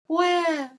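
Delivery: high mock baby wah; imitating baby cry. Imitating the newborn's cry in the toilet, high wail. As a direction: squeal a tiny baby cry. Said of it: "I hear one cry, like a".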